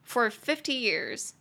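slow, spaced English; slow drilled phrase. Shown as a demonstration. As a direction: say "for fifty years" slowly. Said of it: "phrase drill".

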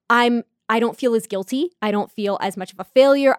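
normal talking voice

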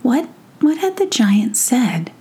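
ordinary delivery, nothing marked

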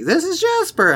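high-pitched mock introduction; imitating a friend. Recalling her in the recorded montage, high mimicry. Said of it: "her being like".

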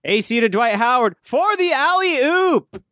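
scripted excitement, high, drawn-out play-by-play call; mock an announcer reading big excitement off a script; Mike Trudell. Host mocking a bad play-by-play announcer, high, slow scripted excitement. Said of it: "somehow be excited, but it would sound like he was reading from a script".

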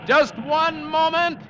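high, loud yell over the wind; shouted orders. Acted. Skipper yelling to Halvard at the wheel, loud. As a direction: roar the order to hold course. Calling through the narrow passage.

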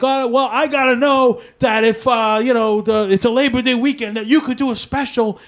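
radio producer yelling orders at a job candidate; retold yelling. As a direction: bark it like a boss laying down demands. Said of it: "Tim starts yelling at me".

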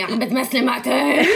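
lightly voiced eager girls; girls asking. Girls asking her about Tim, a hint of eager fan voice. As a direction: tease the fans' excited question. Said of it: "البنات صراحة اللي سألوني".

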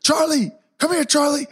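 high call across the yard; a kid's high shout for his dog. Retold or imitated. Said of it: "I hear".

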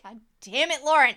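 loud, high mock-exasperated outburst; mock outrage. Podcaster scolding the story's sender for creeping her out, mock outrage. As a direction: curse an absent friend in hammed-up outrage.

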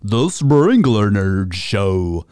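high, drawn-out nerd voice; nerdy voice. Acted. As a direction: put on your nerdiest voice. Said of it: "that was my nerdiest voice that I could come up with".

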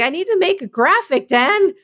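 high, pleading little voice; begging Dan for help like a child; mock whiny self. A podcaster mimics herself fretting over a banner, high and pleading. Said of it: "I was like".

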